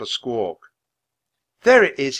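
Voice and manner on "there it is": high, loud raven croak. Acted. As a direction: croak out the discovery. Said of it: "he croaked".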